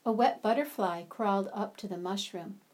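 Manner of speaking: ordinary talk, nothing marked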